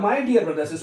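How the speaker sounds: usual speaking voice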